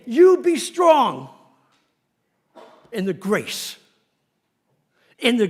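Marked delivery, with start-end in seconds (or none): 0.0-1.3 you be strong, slow and booming; preacher's slow loud command; loud, slow charge. Acted. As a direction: drive each word home.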